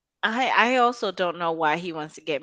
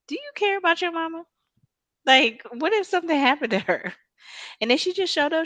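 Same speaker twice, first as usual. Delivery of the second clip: normal talking voice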